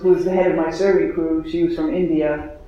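ordinary talk

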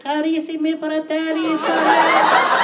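put-on high, slow Italian-accented papal greeting through laughter; Pope Benedict. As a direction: imitate a very high-voiced old pope, stretching sorelle. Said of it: "Pope Benedict, when he came".

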